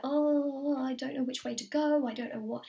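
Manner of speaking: mock helpless wail, easing off; overwhelmed. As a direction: act out being lost and overwhelmed. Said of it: "things can just feel so overwhelming".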